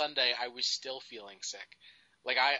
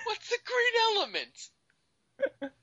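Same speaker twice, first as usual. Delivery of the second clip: put-on very high, slow drunk wail; pitched-up whining drunk; 4 dB louder, much slower than usual. Drunk voice game continues.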